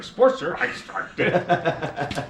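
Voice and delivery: loud retelling breaking into laughter; self-mocking laugh. Real, not acted. Rider recalls underestimating the old Sportster, laughing. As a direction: boom it out, then crack up laughing.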